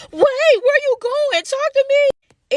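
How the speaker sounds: very high, slow pleading whine; high drawn-out mocking plea; mock whining plea. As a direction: mimic her begging like a sick puppy. Mimicking begging coworker.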